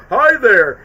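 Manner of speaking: high, loud greeting in a cartoon voice; Seth Rogen's Bob. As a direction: call out like an eager cartoon blob. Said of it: "favorite Seth Rogen voiceover role where he goes".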